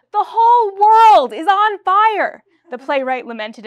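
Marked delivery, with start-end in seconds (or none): 0.1-2.4 slow, high, loud tragic lament. Acted. Playwright lamenting to the producers, slow, loud and tragic. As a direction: mourn it to the rafters, slowly. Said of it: "in a tragic voice".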